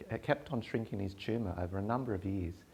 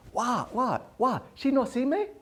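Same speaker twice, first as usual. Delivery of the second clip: high, loud broken-English grumble; an offended old dad nudging his daughter; imitating indignant father; 9 dB louder than usual. Father jabbing elbow, indignant.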